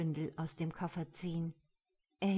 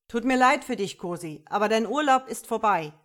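loud, higher announcement; megaphone bellow; 3 semitones higher, 10 dB louder than usual. A narrator's reading. Commissioner to robber over megaphone, bellowing. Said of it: "brüllte ins Megafon".

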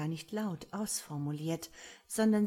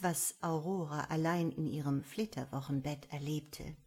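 ordinary delivery

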